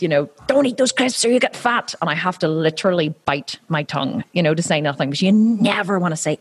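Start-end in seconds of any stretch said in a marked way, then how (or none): 0.5-2.0 higher, scolding parent voice; a hint of a stern mother; mother tempted to scold her kids; mock nagging mum. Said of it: "I really have wanted to say".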